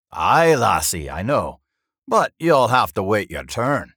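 loud dwarf banter; teasing dwarf. A narrator's reading. Dwarf teasing the flustered lass, loud and hearty. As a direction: tease heartily, with drawn-out words.

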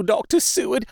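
high, desperate cry of Doctor Seward; frantic tearful plea. A narrator's reading. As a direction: call his name in tearful desperation. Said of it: "poured forth a torrent of entreaty".

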